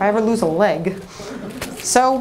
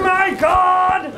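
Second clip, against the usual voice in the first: shocked cry; 5 semitones higher than usual. Acted. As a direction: blurt it out in horror. Shocked at underpants gift.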